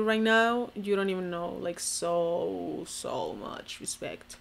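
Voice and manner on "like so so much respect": low slow whisper. Real, not acted. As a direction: breathe it out softly. Trailing low sincere praise.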